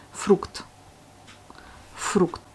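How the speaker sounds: fruct said softly with a clear f, the last one almost breathed; Russian word demo. Shown as a demonstration. Teacher giving the Russian for fruit. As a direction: fruct with a soft, clear final kt. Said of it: "vous avez le mot fruit".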